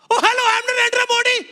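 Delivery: put-on loud, very high-pitched caricature Indian accent; over-the-top squeaky Indian accent; Narendra Modi. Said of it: "I walked into that studio and I went".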